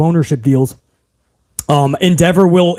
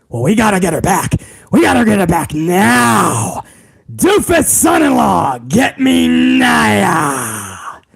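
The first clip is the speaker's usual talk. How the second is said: Vince McMahon. Retold or imitated. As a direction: demand it like a ranting boss. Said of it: "Vince McMahon was like".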